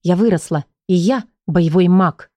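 plain reading voice

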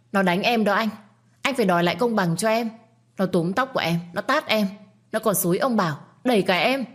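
high, fast whiny complaint; chattering tattletale. Acted. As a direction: rattle off accusations to her brother. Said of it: "mồm năm miệng mười".

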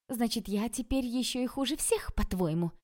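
high, indignant question; offended challenge. A narrator's reading. Girl twists Oleg's words against him, high offended challenge.

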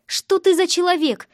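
high, indignant outburst; blurted out. A narrator's reading. Sister pushing the man away, indignantly blurting. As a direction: blurt it out in outrage. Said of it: "выпалила я".

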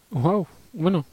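surprised. Real, not acted. Surprised at the result.

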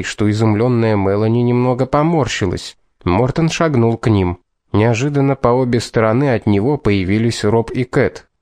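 ordinary delivery, nothing marked

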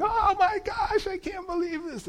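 very high, shrill-pitched mock shriek; high falsetto yell of disbelief; imitated screaming customer. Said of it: "just yelling".

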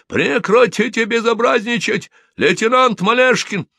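high, loud furious reprimand; choking with anger. A narrator's reading. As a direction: yell at a junior officer in rage. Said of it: "чуть не задохнулся от злобы". (злобный начальственный крик, высоко)

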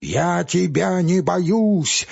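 slow, dragged-out shout; mild shout of defiance. Acted. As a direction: bellow it at him. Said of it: "закричал он".

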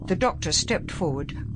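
ordinary delivery

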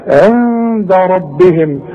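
عند ربهم very slow, with a nasal hidden نون; nasalized recitation. Shown as a demonstration. Teacher recites عند ربهم with a hidden nasal نون. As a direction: hum the نون into the دال. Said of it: "حاول واخفي".

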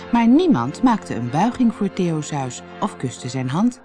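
plain reading voice

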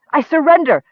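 high, loud panicked cry; desperate high shout of surrender; frightened cry. Acted. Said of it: "the plain-faced woman cried".

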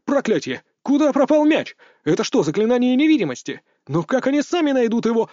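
excited sports commentator, high pitch; play-by-play commentary. Acted. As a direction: call the match live. Commentator announcing the match.

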